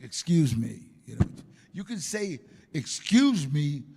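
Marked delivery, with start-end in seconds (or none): 0.0-0.8 a plain, polite "Excuse me". Shown as a demonstration. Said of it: "I mean polite like". 2.7-3.8 slow, loud menacing "Excuse me"; threatening. Shown as a demonstration.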